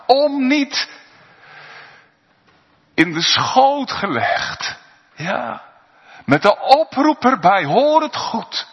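high, loud, impassioned preaching; full-voiced revival preaching; preaching at full force. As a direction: proclaim it with fire from the pulpit. Sermon climax at full force.

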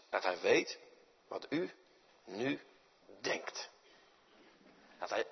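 soft, low and slowing; hushed. Acted. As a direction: let it sink in almost softly. Preacher hushes on God's knowing.

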